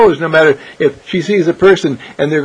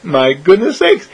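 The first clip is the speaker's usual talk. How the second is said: plain reading voice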